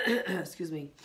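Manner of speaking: ordinary talk, nothing marked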